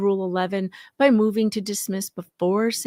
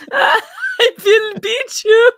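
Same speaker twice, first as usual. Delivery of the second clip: laughing barbarian; 11 semitones higher, 8 dB louder, slower than usual. Retold or imitated.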